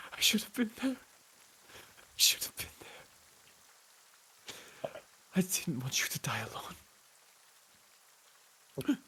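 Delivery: high sob breaking into a whisper; shattered man's breathy, weeping lament; crying out in grief. Acted. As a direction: gasp it out through tears, voice giving way. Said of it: "he's just crying out".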